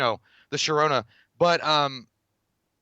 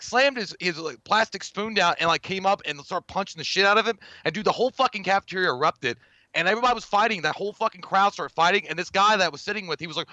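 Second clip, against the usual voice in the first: normal talking voice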